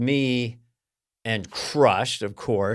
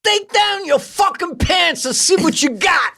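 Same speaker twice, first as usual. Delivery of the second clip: much higher, louder hammed-up crude shout; mock crude shout; 5 dB louder than usual. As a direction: yell it like a pushy brute. Acting aggressive guy.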